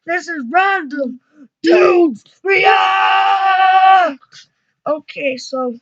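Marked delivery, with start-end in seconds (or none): 0.5-4.2 big shouted channel name; shouting. Real, not acted. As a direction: yell the show name.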